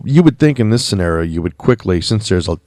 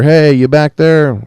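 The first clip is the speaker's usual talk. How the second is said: raised, drawn-out call to someone out back; higher, stretched-out holler in retelling; mild mock yell; 6 semitones higher, 5 dB louder, slower than usual. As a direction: imitate a sheriff calling to the back, slow and louder. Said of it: "yelling back there".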